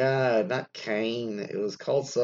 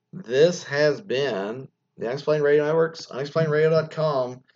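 raised, projected announcer voice; radio sign-off; 6 semitones higher, 5 dB louder than usual. Acted. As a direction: announce the show's close on air.

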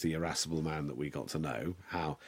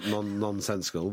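ordinary delivery

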